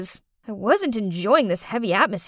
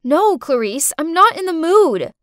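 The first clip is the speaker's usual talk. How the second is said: louder, irritated shout; 6 semitones higher, 7 dB louder than usual. Acted. Babysitter shouting at the girl pestering her, louder. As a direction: snap back a firm no. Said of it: "I shouted".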